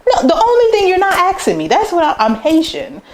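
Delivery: high, loud, fed-up correction; exasperated retort. Retold or imitated. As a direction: correct them, half amused, half annoyed. Retorting to guessing classmates.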